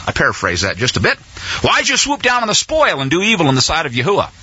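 normal talking voice

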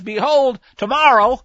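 loud prophetic warning; loud threat. Acted.

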